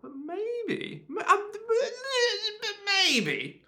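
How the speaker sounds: very high-pitched reluctant maybe; strained, squeaky hesitation. Real, not acted. Co-host suggests a beloved character dies, squeaky and hesitant.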